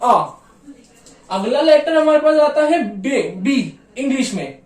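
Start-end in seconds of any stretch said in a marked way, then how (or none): 0.0-0.3 आ as a long open ah; German A. Shown as a demonstration. Teacher repeating the German letter for the class. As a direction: hold आ open and clear. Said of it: "इसकी pronunciation आती है क्या".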